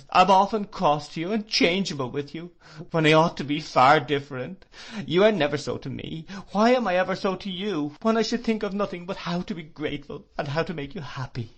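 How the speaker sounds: high, loud tearful pleading; sobbing. Acted. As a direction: pour it out through sobs. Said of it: "she sobbed".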